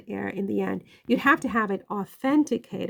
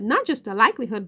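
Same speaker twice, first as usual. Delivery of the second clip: ordinary talk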